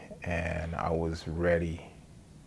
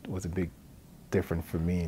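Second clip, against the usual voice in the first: ordinary talk, nothing marked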